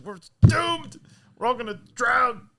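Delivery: high, loud wail of doom; hammed-up panicked cry; mock panic. Officers discussing the sinking, mock panic.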